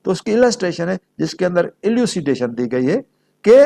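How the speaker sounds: ordinary talk, nothing marked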